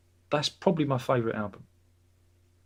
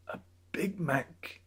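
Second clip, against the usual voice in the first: hushed, slow whisper of the prize name; mock-secret whisper; 4 semitones higher, 8 dB quieter, much slower than usual.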